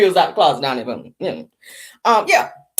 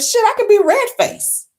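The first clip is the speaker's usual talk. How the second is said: excited scheming; 10 semitones higher, 3 dB louder than usual. Retold or imitated. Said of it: "I said".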